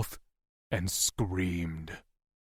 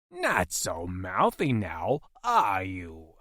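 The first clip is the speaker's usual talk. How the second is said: lower, slow mocking taunt; gloating captor; 3 semitones lower, slower than usual. Captor taunting his stabbed prisoner, slow gloat. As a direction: savour his pain as you sneer.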